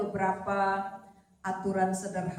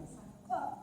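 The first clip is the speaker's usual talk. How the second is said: ordinary delivery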